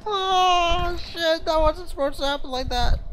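squealing high through helpless laughter; hysterical laughter. Real, not acted. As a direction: barely get it out, laughing and crying. Laughing hysterically at game mishap.

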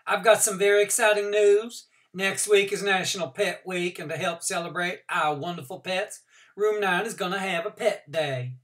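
high, bright classroom call for attention; teacher's announcement voice. A narrator's reading. Teacher announces exciting news.

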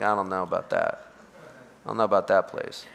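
low, soft, noncommittal reply; half-hearted retold doubt; mock reluctance. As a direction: imitate people hedging, voice dropped low and quiet. Skeptical listeners' reluctant reply.

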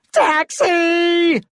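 high, long-held call; high-pitched hailing cry; hailing a cab. A narrator's reading. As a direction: holler down the street for a cab.

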